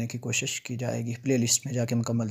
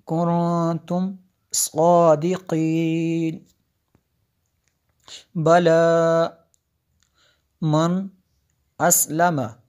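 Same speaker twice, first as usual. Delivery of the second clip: slow, higher, louder tajweed recitation; 3 semitones higher, 4 dB louder, much slower than usual. Shown as a demonstration. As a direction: chant it out like a qari. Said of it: "teacher reciting verse". (ऊँची, धीमी तिलावत)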